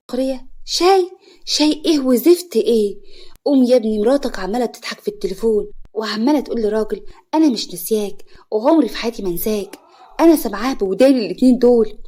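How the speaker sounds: loud, scornful outburst; sarcastic. A narrator's reading. Mother scoffing at her son's excuse about tea, loud sarcasm. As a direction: throw the word back mockingly, then press on loudly. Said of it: "فوزية بسخرية".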